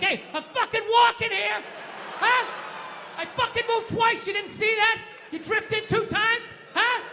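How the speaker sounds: put-on high-pitched old-school New York confrontation; Dustin Hoffman, De Niro. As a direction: shout it indignantly, pushing the pitch way up. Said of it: "old school Dustin Hoffman, De Niro, like".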